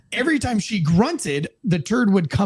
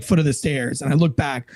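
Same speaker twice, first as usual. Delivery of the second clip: ordinary delivery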